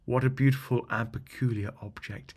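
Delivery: ordinary delivery, nothing marked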